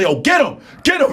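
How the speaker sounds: hyped-up shouts of get him; egging a fighter on; cheering from the crowd. Retold or imitated. Karate guys cheer him on, hyped-up shouting.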